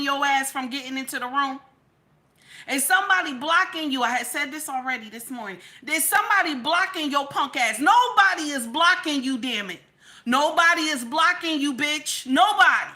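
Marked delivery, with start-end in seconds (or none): 5.8-12.9 loud, emphatic outrage; angry rant. Real, not acted. Scolding the mother over her babies, angry and emphatic. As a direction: hit each denial hard and high.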